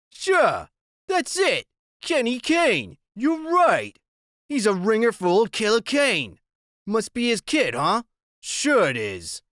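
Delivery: taunting gang boss; menacing biker. Acted. Narrator voices Iggy.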